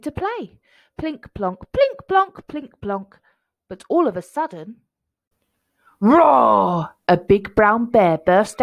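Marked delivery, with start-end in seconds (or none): 1.7-2.5 high, loud piano plink-plonk; bright burst of mimed piano notes. A narrator's reading. Imitating piano notes in story. 6.0-6.9 loud, drawn-out mock bear roar; big playful roar; storybook roar. A narrator's reading. Bear bursts out roaring.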